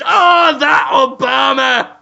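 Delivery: hammed-up high, shouted lament. Poor Victorian housemaid complains of her lot, hammed-up shouted wail. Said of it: "And [name] says".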